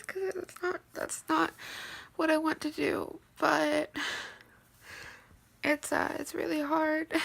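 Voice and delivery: softly crying alone late at night; real teary. Real, not acted. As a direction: speak quietly through real tears at five in the morning. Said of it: "I am so in tears".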